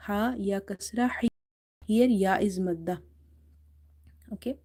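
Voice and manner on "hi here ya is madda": ordinary delivery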